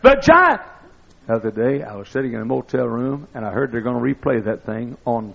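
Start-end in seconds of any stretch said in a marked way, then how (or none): 0.0-0.6 high, loud ecstatic radio call; announcer screaming the winning call. Retold or imitated. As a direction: scream it like the famous home-run call. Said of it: "he kept screaming".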